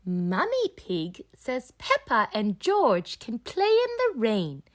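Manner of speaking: ordinary delivery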